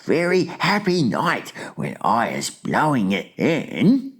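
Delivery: high, thrilled giant character voice; delighted giant. A narrator's reading. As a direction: marvel aloud at a precious catch. Excited giant admires his catch.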